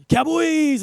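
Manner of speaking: high, loud imitation of a child's cheer; excited little girl. Daughter spots dad's Cowboys jersey, imitated excited shout. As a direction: squeal it out like an excited small kid. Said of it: "she'll say".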